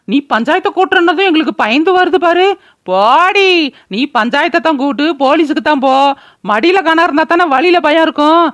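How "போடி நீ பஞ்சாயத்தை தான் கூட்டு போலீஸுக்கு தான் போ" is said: ordinary talk, nothing marked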